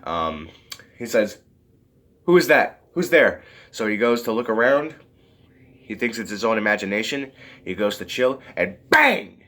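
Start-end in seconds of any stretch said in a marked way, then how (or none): 2.2-3.4 raised, louder call into an empty house; mock alarmed calling. Man in abandoned house hears a whoosh, calls out loudly. 8.9-9.4 high, punched sound-effect word; sudden exclamation. Acted. Recounting the ninja zombie's ambush, sudden high exclamation.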